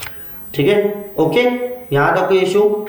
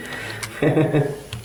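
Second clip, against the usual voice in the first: quiet, breathy chuckle; short real laugh under the breath; soft laugh; 7 dB quieter than usual. Real, not acted. Laughing after correcting the students. (हल्की, धीमी हँसी)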